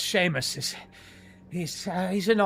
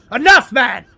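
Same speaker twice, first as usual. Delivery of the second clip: high, loud angry yell; angry shout; 10 semitones higher, 17 dB louder than usual. Acted. Tomanthi striking the dazed Seamus, angry shout. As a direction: bark him out of it. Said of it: "Tomanthi strikes Seamus".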